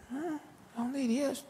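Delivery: high, light puzzled question; puzzled wonder. Retold or imitated. Wife finds husband gone, asking herself where. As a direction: wonder aloud where he went.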